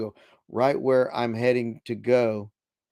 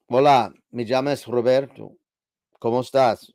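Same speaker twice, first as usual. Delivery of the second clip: Hola said in Spanish, a bit louder than his chat; Spanish greeting; 4 dB louder than usual. Retold or imitated. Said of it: "I would say".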